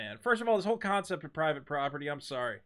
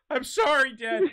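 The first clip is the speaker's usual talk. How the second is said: pleading son; 7 semitones higher, 6 dB louder than usual. Acted. Acted son apologizing to father.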